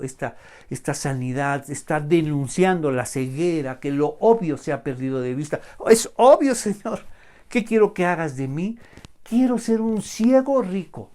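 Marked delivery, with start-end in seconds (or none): 5.9-11.1 hammed-up, loud and high; mock greedy. Preacher mocking people who ask Jesus for riches. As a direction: play the greedy believer.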